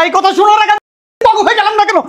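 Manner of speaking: high-pitched, exasperated cry; worked-up quarrel voice; angry outburst. Acted. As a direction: raise the pitch sharply in anger. Furious father-side quarrel, insults.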